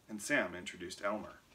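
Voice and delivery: ordinary delivery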